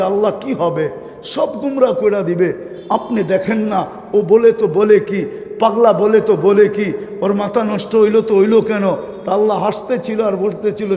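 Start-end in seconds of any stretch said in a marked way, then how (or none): none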